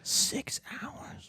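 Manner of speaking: whispered aside. Real, not acted. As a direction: breathe it under your breath.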